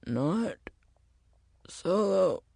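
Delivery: soft, halting, effortful words; straining voice. Acted. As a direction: strain to get each word out. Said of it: "his voice straining".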